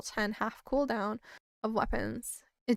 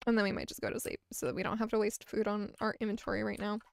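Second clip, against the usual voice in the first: ordinary delivery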